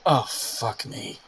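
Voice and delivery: low, slow, dismayed groan of words; dismayed character. Acted. Character seeing the newcomer at the party, slow dismay. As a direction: let it sink out slowly, resigned. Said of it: "she would've been like".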